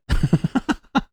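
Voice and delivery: high-pitched burst of laughter; high cackle. Real, not acted. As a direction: cackle at your own joke. Laughs at own joke.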